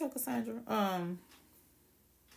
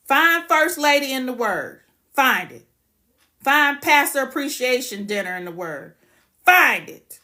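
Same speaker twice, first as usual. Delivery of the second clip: loud, furious repeated challenge; heated; 3 semitones higher, 10 dB louder than usual. Real, not acted. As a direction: shout it, daring them to find it. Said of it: "Y'all got me heated".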